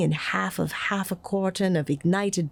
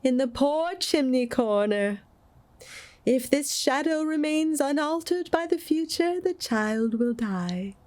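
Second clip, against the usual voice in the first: solemn ghost; 8 semitones higher than usual. A narrator's reading. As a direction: pronounce it like a prophecy. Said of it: "replied the ghost".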